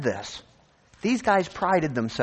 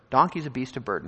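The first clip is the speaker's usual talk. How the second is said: ordinary talk, nothing marked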